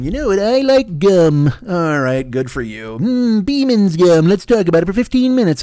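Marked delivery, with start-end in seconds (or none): 0.0-1.5 a lightly voiced slow dull podcaster; mock a self-important nobody. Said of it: "somebody with a microphone just going". 3.0-5.6 mock podcaster. Mock gum podcaster, slow, far.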